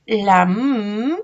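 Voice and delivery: "m" held as a long, loud hum; sustained letter sound. Shown as a demonstration. Teacher showing children the written letter, "m" as a sustained hum. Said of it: "ahí está escrita la m".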